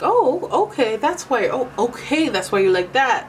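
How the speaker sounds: high, loud "oh, okay" of dawning understanding; sudden realisation. Retold or imitated. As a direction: act out someone suddenly getting it. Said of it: "and they're like".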